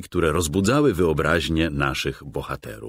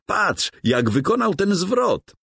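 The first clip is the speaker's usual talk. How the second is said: much higher, thrilled call; excited exclaim. A narrator's reading. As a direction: cry out in wonder. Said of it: "wykrzyknął Tymoteusz".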